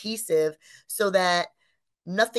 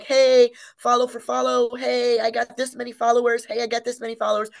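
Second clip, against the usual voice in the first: higher, louder pushy hey voice; mock pushy small business; 5 semitones higher, 5 dB louder, faster than usual. Mocking desperate businesses online, loud pushy hey. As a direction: imitate a needy follower-chaser. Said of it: "they'll be like".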